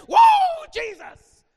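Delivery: very high, loud whoop; energetic shout. Retold or imitated. Southern Baptists running around the stage, energetic shout. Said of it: "they got energy and they're like".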